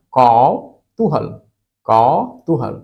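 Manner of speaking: कौतूहल slowly, loud kau, then too-hal; syllable-by-syllable model. Shown as a demonstration. Teacher drilling the correct word, कौतूहल split after a long kau. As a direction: lean long on कौ in कौतूहल, pause, then तूहल. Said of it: "कौतूहल होता है".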